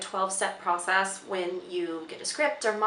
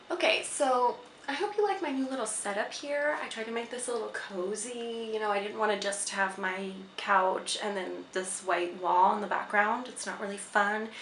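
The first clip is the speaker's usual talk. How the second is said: ordinary delivery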